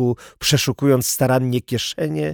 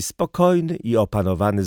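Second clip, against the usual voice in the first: plain reading voice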